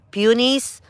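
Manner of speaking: peonies said as PYOO-nees with a rising, questioning tune; "peonies" as PYOO-nees, tentatively; careful pronunciation. Shown as a demonstration. Gardener testing the proper way to say peonies. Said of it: "they said it should be peonies".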